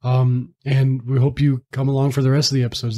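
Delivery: usual speaking voice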